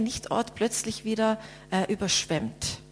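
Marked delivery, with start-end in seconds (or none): none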